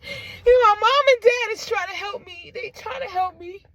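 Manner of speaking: real crying through the words; voice breaking. Real, not acted. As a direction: choke up hard while explaining. Breaks down recalling parents helping her.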